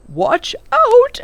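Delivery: high, loud cheeky call; playful warning shout. A narrator's reading. Professor warns the children before shaking the bottle, grinning shout. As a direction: yell a gleeful warning with a grin. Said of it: "he said, and with a grin".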